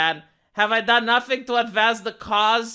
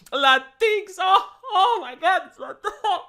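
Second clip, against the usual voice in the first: mock tears of joy; 11 semitones higher than usual. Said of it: "tears of joy over his face".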